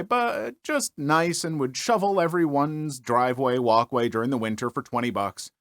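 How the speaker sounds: normal talking voice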